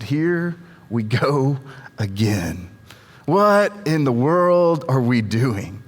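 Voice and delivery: slow, low, weary grumble; eye-rolling exasperation. Acted. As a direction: drag it out, dropping low on "again". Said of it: "they rolled their eyes and said".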